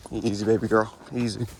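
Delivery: soft, hushed reassurance; soothing the horse. Real, not acted. Rider hushing his mare to keep her calm, soft and soothing. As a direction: settle her down gently. Calming the horse while riding.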